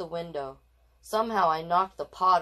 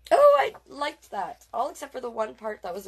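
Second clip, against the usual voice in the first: delighted; 7 semitones higher, 6 dB louder than usual. Real, not acted. Reader gushing to viewers after the story, delighted. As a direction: savor it with delight.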